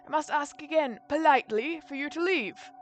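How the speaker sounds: put-on high, loud, indignant old servant; crabby old butler. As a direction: scold the late caller as a cranky butler. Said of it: "feels like a crabby old butler".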